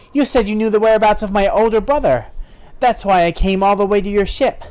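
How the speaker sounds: high, insistent heroine voice; acted sister demanding answers; indignant sister.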